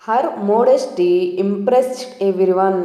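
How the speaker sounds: very slow, word by word; slow example sentence. Shown as a demonstration. Teacher reads the example sentence very slowly to learners. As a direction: read it slowly for the class. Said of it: "second example".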